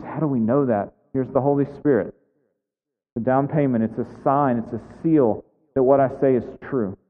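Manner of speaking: usual speaking voice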